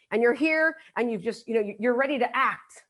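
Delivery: ordinary delivery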